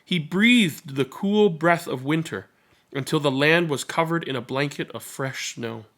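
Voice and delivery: plain reading voice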